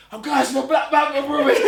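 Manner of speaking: high, loud shout through laughter; overjoyed yelling about the premiere; ecstatic shout. Retold or imitated. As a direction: yell it while running laps in joy. Running round gym shouting.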